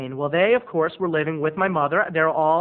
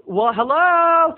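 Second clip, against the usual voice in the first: high, loud, drawn-out hello; calling through door; 12 semitones higher, 7 dB louder, much slower than usual. Retold or imitated. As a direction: call out to someone behind a closed door. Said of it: "through the door".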